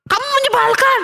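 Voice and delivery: high, mild mock yell; retold shout. A narrator's reading. Theo shows mother what he shouted, mock yell. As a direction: re-enact a cross shout. Child re-enacts his shout.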